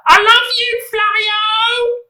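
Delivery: very high, loud screech of a swooning fan; screeching woman. A narrator's reading. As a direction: screech it like a starstruck mum. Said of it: "woman's voice screech".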